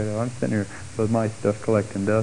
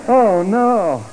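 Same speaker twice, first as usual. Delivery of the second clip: mock panic; 10 semitones higher, 6 dB louder than usual. Panic over the price.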